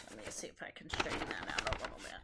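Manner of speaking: fast low mutter; quick mumble to yourself; muttered aside. Real, not acted. Muttered aside while adjusting.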